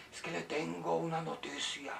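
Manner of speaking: hushed, put-on gossip voice; mock confidential tone; imitated gossip. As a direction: mimic a tattler softly passing on news. Mimics a gossip bringing news.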